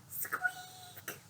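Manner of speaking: tiny whispered squeak; high mouse squeak. Acted.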